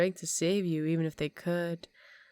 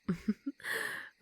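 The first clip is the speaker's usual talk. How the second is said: whispered laugh; 2 semitones higher, 7 dB quieter than usual. Acted. Vampire whispers over purring captive.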